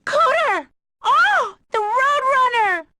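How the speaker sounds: high, loud delighted cry; overjoyed, calling out; thrilled surprise. Acted. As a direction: squeal with joy at the car. Sees her restored car.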